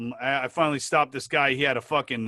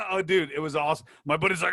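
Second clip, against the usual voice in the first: normal talking voice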